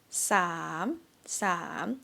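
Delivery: sam dipping then rising. Shown as a demonstration. Teacher modelling rising tone on sam. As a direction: lift sam up at the end. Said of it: "the sweetest tone".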